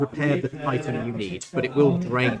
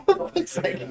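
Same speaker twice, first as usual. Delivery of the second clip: laughing in pain; 11 semitones higher than usual. Real, not acted. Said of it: "I just bit my tongue".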